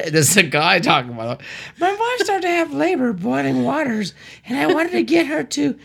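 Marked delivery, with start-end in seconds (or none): 1.8-5.8 high nervous driver voice. Acted. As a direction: squeak it out like a flustered driver. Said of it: "Do different voices".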